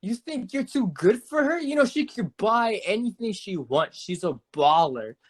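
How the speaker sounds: put-on high, loose, pushy drunk woman voice; imitating drunk woman. Storyteller imitating the drunk woman pushing her friend on him, high and pushy. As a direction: slur it like a tipsy stranger pressing a guy.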